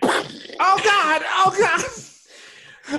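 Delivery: high, loud terrified cry; panicked scream. Acted. Flashbang thrown into room.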